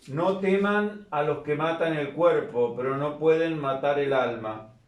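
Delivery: soft, measured scripture reading; liturgical reading. Acted. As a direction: read it like a priest at mass. Reads the gospel aloud.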